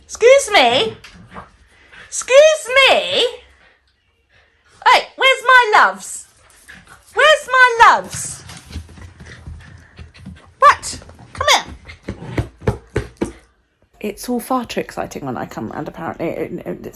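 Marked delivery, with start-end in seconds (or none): 0.0-8.4 squeaky high greeting for the neighbour's dogs; dog-talk voice. Real, not acted. 10.6-13.3 very high cooing call; high cooing to a dog; dog-talk coo. Real, not acted. Cooing at the dogs.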